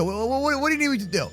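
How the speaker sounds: normal talking voice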